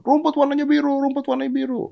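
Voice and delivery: high, chanting donkey shout; donkey shouting as he leaves. Acted. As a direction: crow in triumph. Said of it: "keluar teriak-teriakan". (keledai berteriak sambil keluar)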